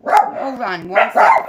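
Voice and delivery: telling the barking dogs to stop, loud and firm; scolding dogs. Real, not acted.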